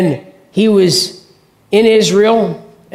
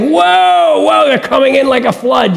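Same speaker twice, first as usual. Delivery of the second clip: mock alarm; 5 semitones higher than usual. Mock panic at the enemy.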